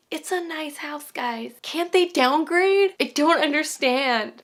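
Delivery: high-pitched, incredulous pleading; exasperated disbelief. Real, not acted. Reviewer pleading with viewers over the nice house, exasperated disbelief.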